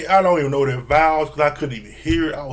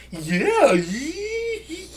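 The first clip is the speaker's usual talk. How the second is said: much higher, silly squeaky yeahs; goofy groom; 14 semitones higher than usual. Retold or imitated. Mimicking the nervous groom at the altar, squeaky and high. Imitating Eric's nervous yeah.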